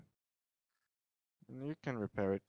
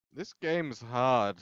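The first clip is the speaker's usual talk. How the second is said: ordinary delivery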